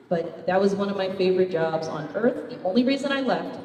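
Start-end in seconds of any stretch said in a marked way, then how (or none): none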